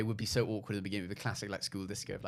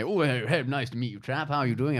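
higher, louder posh bluster; Boris Johnson impression; 3 semitones higher, 6 dB louder than usual. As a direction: play a blustering posh politician. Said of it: "That was a brilliant Boris Johnson impression".

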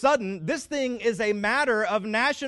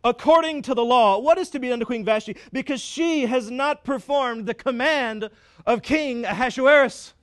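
mock pompous king; 5 semitones higher, 5 dB louder than usual. Preacher mimics the king's third-person decree, mock-grand and louder. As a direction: puff up like a king talking about himself. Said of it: "he even talks in the third person".

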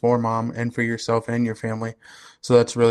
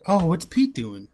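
wondering aloud; 6 semitones higher than usual. Retold or imitated. Voicing his own thought.

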